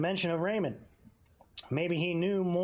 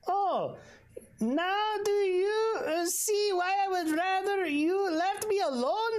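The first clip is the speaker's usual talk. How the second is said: high, eager hotel host voice; fawning innkeeper, pitched up; hotel owner greeting the detective, high and eager; Ernest Bull; 14 semitones higher than usual. Acted.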